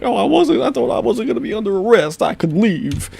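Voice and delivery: mock him in a squeaky simpering voice; squeaky mocking whine. Vlogger mocking the suspect's claim in a squeaky whine.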